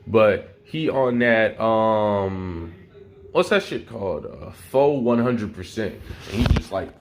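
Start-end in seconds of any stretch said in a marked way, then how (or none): none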